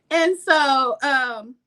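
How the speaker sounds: usual speaking voice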